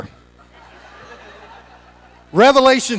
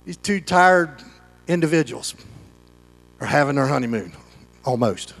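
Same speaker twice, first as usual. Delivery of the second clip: worn-out fade to almost nothing; fading tired; 6 semitones lower, 6 dB quieter than usual. Retold or imitated. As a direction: voice sinking softer and lower. Tired couple at dawn, fading.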